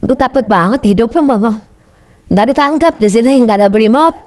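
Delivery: ordinary talk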